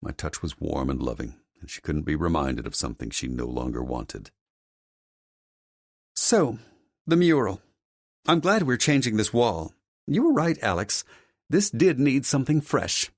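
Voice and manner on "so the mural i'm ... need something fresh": high-voiced mother changing the subject; composed mother character. Mother turns back to the mural wall, brisk high character voice. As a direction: move on grandly as the haughty mother.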